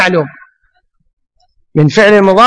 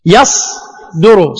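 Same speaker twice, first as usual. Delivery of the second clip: يصدر drawn out slowly; lingering on يصدر; slow demo; 5 semitones lower, much slower than usual. Shown as a demonstration. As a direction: stretch يصدر syllable by syllable. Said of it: "مثل حالتنا في كلمة مصدر".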